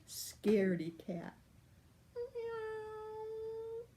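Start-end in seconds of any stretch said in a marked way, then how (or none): 2.1-3.8 much higher, soft little cat voice; meowing cat. Acted. The shrunken lion moans in a tiny high cat voice. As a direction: squeak it out like a tiny scared cat. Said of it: "he meowed".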